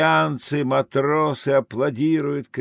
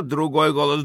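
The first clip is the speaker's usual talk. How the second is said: normal talking voice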